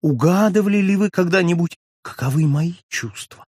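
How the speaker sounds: Darcy, suddenly animated, pressing Julie about his feelings. A narrator's reading. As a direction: press her with rising passion. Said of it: "вскричал Дарси, оживляясь".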